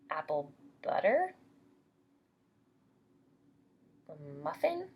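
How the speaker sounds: put-on lively storybook reading for little kids; bright teacher storytime voice; reading to children. A narrator's reading. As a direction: read it like storytime for preschoolers. Teacher reads picture book to class.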